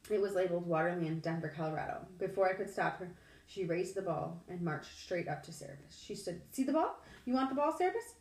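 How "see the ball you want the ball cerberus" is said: high, loud coaxing; girl coaxing a monster dog, high and bright. A narrator's reading. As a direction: tempt a dog with a ball, pitched up.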